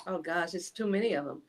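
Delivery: ordinary delivery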